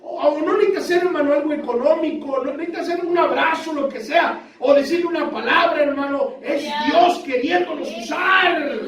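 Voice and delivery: high, urgent pulpit exhortation; fervent preaching. Real, not acted. Preacher urges the brothers to act on the heart's prompting, fervent preaching. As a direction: preach it high and driving, stretching the last words.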